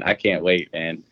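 ordinary talk, nothing marked

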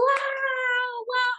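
high impressed wow from the crowd; impressed onlookers. Retold or imitated. People after the dance, high amazed wow.

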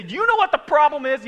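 loud, high yell of the question. Shown as a demonstration. Preacher demonstrates yelling the question, loud. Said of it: "Even if you have to yell it".

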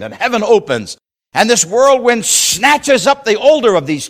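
high, loud preacher's build; preaching at full force. Acted. Preacher to congregation telling of Elijah, full-force preaching. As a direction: ring it out to the congregation.

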